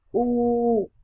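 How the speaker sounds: "U" held long with rounded lips; drawn-out U. Shown as a demonstration. Teacher recapping for learners, "U" rounded and held long. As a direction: stretch "U" steadily. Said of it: "và kéo dài".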